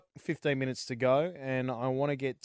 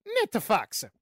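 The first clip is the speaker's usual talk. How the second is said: Italian accent; 8 semitones higher than usual. Shown as a demonstration. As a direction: sing it out in a hammed-up Italian accent. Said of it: "say it in an Italian accent".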